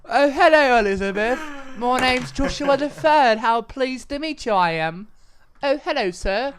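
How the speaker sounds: high, loud, posh-sounding mock British; mock British accent. Shown as a demonstration. Trying his British accent on his friend, hammed-up. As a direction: ham up a stuffy Englishman. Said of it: "my British one".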